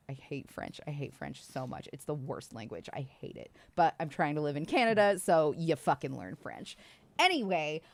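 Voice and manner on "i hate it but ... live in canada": ordinary delivery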